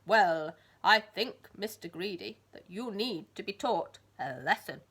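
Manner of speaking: loud, slow, booming threat; stern giant. Acted. Giant telling Mister Greedy off, booming sternly. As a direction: lay down the law like a giant.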